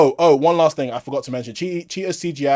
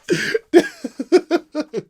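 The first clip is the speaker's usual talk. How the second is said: laughter; 12 semitones higher than usual. Real, not acted. Laughter continues.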